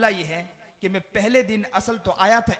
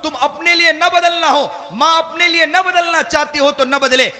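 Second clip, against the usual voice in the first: put-on preacher urging parents to change for their children; forceful preaching; 5 semitones higher than usual. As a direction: drive it home to the fathers. Preacher raises to full force.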